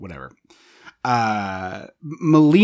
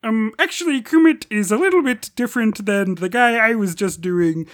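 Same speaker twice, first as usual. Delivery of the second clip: high pedantic frog puppet voice; Kermit; 7 semitones higher than usual. Acted. Podcaster recreates his work bit, sliding into high Kermit voice. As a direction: correct them in a high puppet impression. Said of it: "went from Uber driver voice to Kermit".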